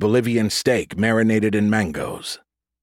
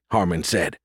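ordinary delivery

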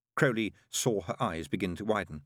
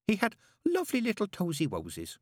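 put-on doting baby talk; 12 semitones higher than usual. Entranced ex-nun gushes baby talk.

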